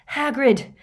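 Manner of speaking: high, loud panted call; panting. A narrator's reading. Harry running into Hagrid, panting. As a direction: cry out as if winded from running. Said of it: "he panted".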